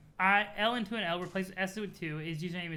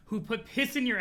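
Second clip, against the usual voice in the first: ordinary talk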